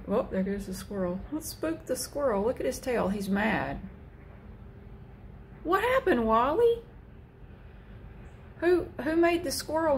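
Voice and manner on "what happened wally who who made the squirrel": higher, sing-song voice for the cat; high pet-talk voice. Real, not acted. Talking to the cat.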